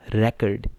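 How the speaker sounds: Record with the stress on the first part, RE-cord; stress on first syllable. Shown as a demonstration. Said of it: "पहले पे है stress". (Record पहले हिस्से पर ज़ोर)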